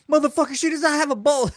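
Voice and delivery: high, fast yelled denial; imitated screaming. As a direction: scream back at the chat. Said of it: "he'll start screaming".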